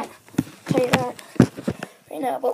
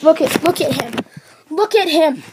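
usual speaking voice

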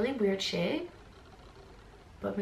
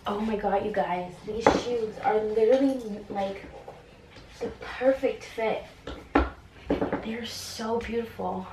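usual speaking voice

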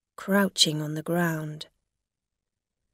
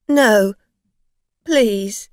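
loud, alarmed begging; desperate plea; 3 semitones higher, 10 dB louder than usual. A narrator's reading. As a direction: beg them to stop. Afraid driver begs: no police.

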